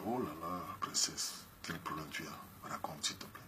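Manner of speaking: slow, hushed, honeyed sweet talk, almost whispered; soft honeyed voice. Retold or imitated. The ex coaxing a married woman in a soft, slow, honeyed voice. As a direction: coax her gently like a smooth seducer. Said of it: "Il parle avec une voix douce, mielleuse".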